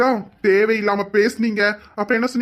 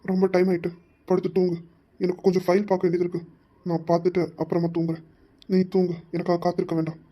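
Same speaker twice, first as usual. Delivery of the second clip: gentle; 4 semitones lower, 6 dB quieter than usual. Acted. Husband gently sends wife to bed.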